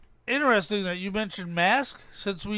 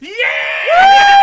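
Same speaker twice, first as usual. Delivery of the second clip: loud, high whoop of triumph; real cheer, very loud and high; excited shout; 13 dB louder than usual. Real, not acted. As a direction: yell it out, thrilled. Guessed the movie, cheering.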